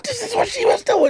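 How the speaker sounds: very high voice through laughter; laughing falsetto. Retold or imitated. Telling his friend how his hair looked, high laughing voice. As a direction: squeal it while laughing.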